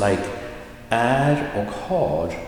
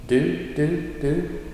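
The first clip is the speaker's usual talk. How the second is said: "du" repeated short, in a higher pitch; 7 semitones higher, slower than usual. Shown as a demonstration. Teacher showing the pronoun is short, "du" kept brief. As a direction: keep each "du" brief.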